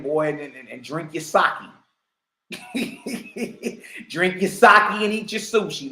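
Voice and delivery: normal talking voice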